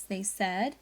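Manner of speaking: usual speaking voice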